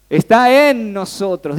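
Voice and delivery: "Está" pronunciado despacio y fuerte; emphatic declaration. Real, not acted. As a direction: recalca "Está" con fuerza y calma. Preacher stresses Spirit within us. (predicador recalca "Está en nosotros", lento y fuerte)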